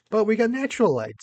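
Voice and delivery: high bright burst of delight; excited. Real, not acted. Upbeat recovery, big pitch jump.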